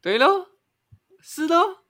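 对咯 and 是咯 with the last syllable rising high; Malaysian rising tail. Shown as a demonstration. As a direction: end 对咯 on a high upward lilt. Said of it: "你的尾音会往上的".

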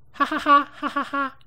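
high, loud mocking cackle; mocking laugh. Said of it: "有一个白目就大喊". (高声嘲笑的大笑)

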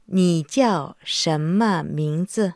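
slow, syllable by syllable; slow teaching demo. Shown as a demonstration. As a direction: model each syllable slowly and clearly. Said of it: "دعنا نتمرن على هذا السؤال".

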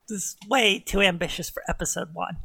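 normal talking voice

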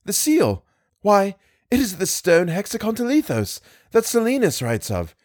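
high, eager breathless rush; excited high cry about the seal; breathless excitement. A narrator's reading. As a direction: burst out with the discovery, pitched high. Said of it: "I cried, breathless".

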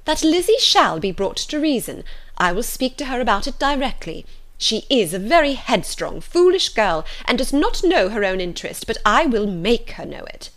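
exasperated mother laying down the law; insistent mother. A narrator's reading. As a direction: vow it loudly, hitting the key words. Voices Mrs Bennet vowing firmness.

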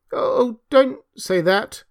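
distressed cry. A narrator's reading. Hans upset at being called unfriendly, pleading. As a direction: beg as if stung by the reproach. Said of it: "cried little Hans".

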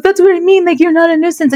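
high-pitched retold objection; imitated son's protest. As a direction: mimic a teen protesting, pitched well up. Son protests, voiced by mother.

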